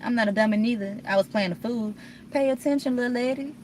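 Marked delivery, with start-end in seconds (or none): 2.3-3.5 higher, teasing pay attention; sing-song scolding. Pretend. Scolding her past self, higher and teasing. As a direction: tease yourself like a wise auntie. Scolding herself, playing the fool.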